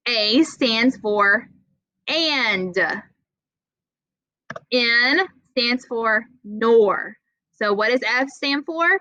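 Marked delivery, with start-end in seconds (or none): 0.1-7.2 slow, teaching pace. Shown as a demonstration. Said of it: "help you remember".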